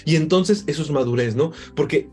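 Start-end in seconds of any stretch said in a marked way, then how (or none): none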